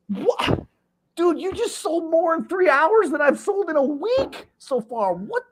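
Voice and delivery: high, incredulous what; disbelieving outburst. Retold or imitated. Salesman to partner, incredulous. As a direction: pitch up in stunned disbelief.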